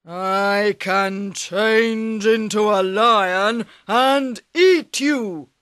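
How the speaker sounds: slow, loud ogre threat; menacing ogre. Acted. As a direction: loom over him, word by word.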